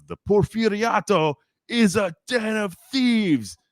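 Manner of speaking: slow, high mock oratorical yell; retold yell. Speaker to a quieting crowd, third time, retold yell. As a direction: draw out each word in a high mock yell. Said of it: "he yells it a third time".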